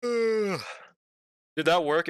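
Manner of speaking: talking through a stretched yawn; half-fake yawn. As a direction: yawn the words out slowly. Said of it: "It was a fake yawn".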